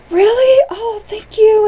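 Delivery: very high, thrilled grateful voice; delighted little character. Acted. Smiley hears he will be called loved, high thrilled voice. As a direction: squeak it out in astonished gratitude. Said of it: "And he goes".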